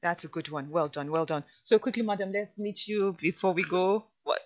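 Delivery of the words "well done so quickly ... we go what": ordinary delivery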